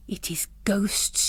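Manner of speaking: hushed, slow inner whisper; whispered thought. A narrator's reading. Miss Dempsey frightened by footsteps overhead, whispering. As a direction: breathe the thought to herself. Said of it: "she thought".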